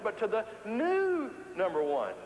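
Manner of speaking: high, slow, with "new" held very long; drawn-out stress. Retold or imitated. Speaker repeating the performers' rule, "new" hugely drawn out. Same punchline repeated, stretched word.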